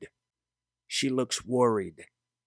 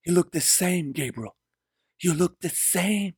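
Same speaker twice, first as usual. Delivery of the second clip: higher, insistent repeated woman's line; 7 semitones higher than usual. Acted.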